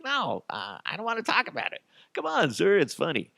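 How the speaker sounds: high, pleading embarrassed voice; flustered protest. Acted. Embarrassed victim begs the officer not to make him say it. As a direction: squirm out of answering.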